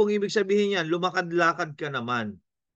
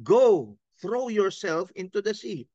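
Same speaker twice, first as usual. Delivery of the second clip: "Go" and "throw yourself" hit higher and harder; stress on go and throw; 4 semitones higher than usual. Shown as a demonstration. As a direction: punch "Go" then "throw yourself". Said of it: "Ang lagyan mo ng emphasis, yung go at saka yung throw yourself". (diin sa "Go" at "throw yourself")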